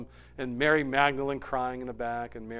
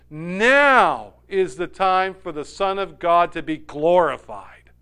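normal talking voice

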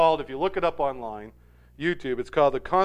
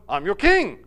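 raised, louder announcement of rank; mock kingly assertion; 4 semitones higher, 7 dB louder than usual. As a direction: play an indignant king lightly. Arthur asserts rank to the peasant.

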